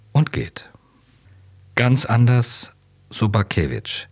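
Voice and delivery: ordinary delivery, nothing marked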